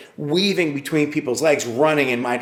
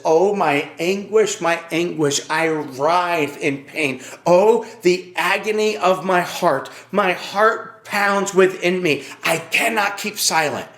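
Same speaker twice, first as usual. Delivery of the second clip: slow, raised-pitch lament; anguished cry; 4 semitones higher, slower than usual. Acted. Preacher voicing God's words in Jeremiah, anguished. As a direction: draw out the agony, understated but heartfelt. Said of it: "Why is he talking like this".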